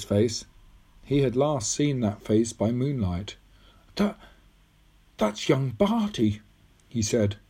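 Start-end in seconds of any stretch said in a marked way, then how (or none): 4.0-6.4 put-on slow, halting, higher boyish voice; falter, then name it slowly; stunned recognition. Tom recognising the face in the photograph, stunned.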